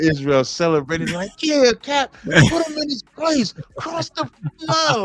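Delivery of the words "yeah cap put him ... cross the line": much higher, gleeful cheer; excited cheering. Retold or imitated. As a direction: cheer like a celebrating fan. Voicing a celebrating crowd.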